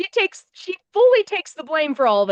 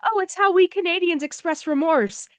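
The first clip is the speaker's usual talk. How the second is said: higher-pitched imitated schoolgirl explaining; lightly voiced Canadian girl; Canadian exchange student; 6 semitones higher than usual. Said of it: "this Canadian exchange student".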